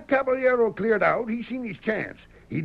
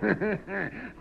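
short, smug chuckle; knowing chuckle; 8 dB quieter than usual. Acted. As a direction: laugh off their doubt. Sheriff mocks the doubters.

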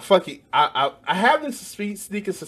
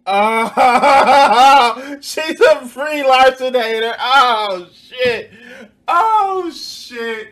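high, loud laughing disbelief; cracking up loudly at the clip; laughing shriek; 11 semitones higher, 13 dB louder, much slower than usual. Real, not acted. Reacting to a clip, cracking up loudly.

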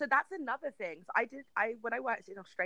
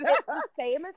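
ordinary delivery

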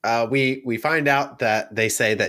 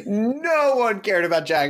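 normal talking voice

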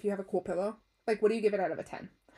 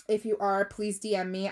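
ordinary delivery

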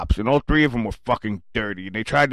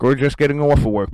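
ordinary talk

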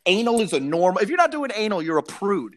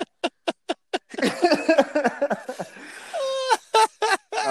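burst of laughter; 10 semitones higher than usual. Real, not acted. Laughing at own story.

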